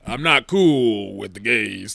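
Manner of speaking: slow, put-on dismissive old-man voice; lightly lampooning a bigoted God on a throne; unimpressed patriarch drawl, hammed up; mock stuffy God.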